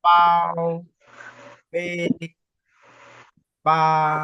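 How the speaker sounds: "ba" loud and level; long, even "ba"; constant first tone. Shown as a demonstration. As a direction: sustain "ba" firmly without movement. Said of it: "Le premier ton et le ton constant".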